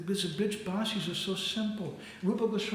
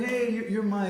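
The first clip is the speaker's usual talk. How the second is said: loud, high, eager address; gushing acted affection; effusive friend; 7 semitones higher, 6 dB louder than usual. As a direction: greet a dear pal with great gusto. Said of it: "Krishna told them".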